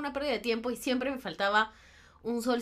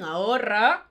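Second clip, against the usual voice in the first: louder mock parent command; parental order; 6 dB louder, slower than usual. Parents telling a teenager to save, as a louder order. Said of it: "adolescente te dicen".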